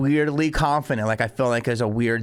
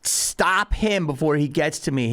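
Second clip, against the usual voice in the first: ordinary talk, nothing marked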